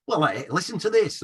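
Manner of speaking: a light impression of Andy Kershaw's northern accent; lightly voiced Kershaw, eager to play a record. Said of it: "great accent of Andy Kershaw".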